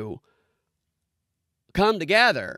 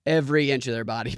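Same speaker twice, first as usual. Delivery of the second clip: ordinary talk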